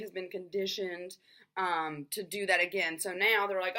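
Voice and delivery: ordinary talk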